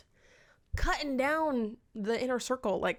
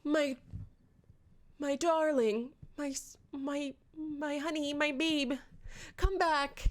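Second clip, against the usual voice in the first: mock doting; 5 semitones higher than usual. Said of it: "Tamlin's like".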